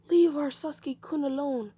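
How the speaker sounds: high-pitched protest from the fans. Acted. Fans calling out for their beaten favorite, high protest.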